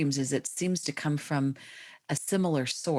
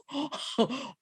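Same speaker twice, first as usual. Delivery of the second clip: retold gasp; 12 semitones higher, 3 dB quieter than usual. Dream voice realization, retold reaction.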